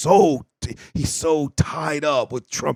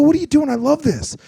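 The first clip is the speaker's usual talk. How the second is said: mock cheerful; 12 semitones higher, 5 dB louder than usual. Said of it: "I go".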